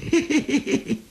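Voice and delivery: high, sly hehehe chuckle. Acted. Xu Liang crouching by Jiang Zhao, sly teasing chuckle. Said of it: "冲蒋昭一乐".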